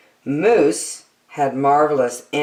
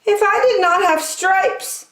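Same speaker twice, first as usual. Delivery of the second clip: higher, bright-eyed zebra. Acted. Zebra stops the friends, in a high voice. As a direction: pipe up with an idea, pitched high. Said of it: "said Zebra".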